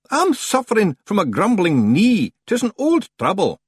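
high, loud Scots voice; Scots stranger excusing himself. Acted. Stranger explaining away a talking knee, high loud Scots. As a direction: cover up loudly in high Scots.

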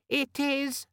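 very high, squeaky little demon voice; chirpy and irritatingly friendly. A narrator's reading. Organizer demon answering Vimes, squeaky and friendly. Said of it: "which had the squeaky, friendly quality".

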